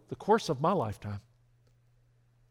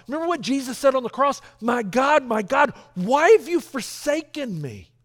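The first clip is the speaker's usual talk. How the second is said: loud, high-pitched preaching climax; preaching at full force; 8 semitones higher, 8 dB louder than usual. Acted. Preacher to the congregation, crying out the cross passage loudly.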